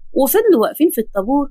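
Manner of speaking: plain reading voice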